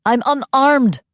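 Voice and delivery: high loud shout; pleading shout. A narrator's reading. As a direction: cry out in protest. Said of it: "the captive shouted".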